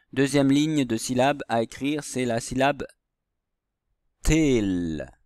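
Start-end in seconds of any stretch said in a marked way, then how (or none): 4.2-5.1 TEL held long, falling in pitch; sustained model reading of "tel"; drawn-out syllable. Shown as a demonstration. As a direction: stretch "tel" slowly for young readers. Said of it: "ça fait tel".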